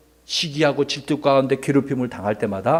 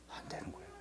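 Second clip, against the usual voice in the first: whisper; 28 dB quieter, much faster than usual. Real, not acted. Sudden whisper to children.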